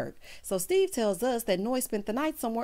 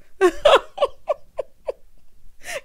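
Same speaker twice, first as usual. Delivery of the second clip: shrieking laugh; 8 dB louder than usual. Real, not acted. Cracks up at the scene.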